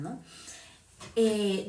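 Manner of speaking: ordinary delivery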